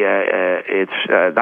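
usual speaking voice

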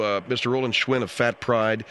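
ordinary talk, nothing marked

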